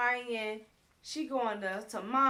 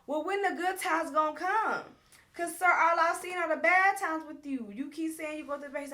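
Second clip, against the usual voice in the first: raised, higher, exasperated challenge; heated argument; 5 semitones higher, 5 dB louder than usual. Retold or imitated. Amara confronting her man about the bad times, heated. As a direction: confront a partner who keeps promising. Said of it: "so they yelling back and forth".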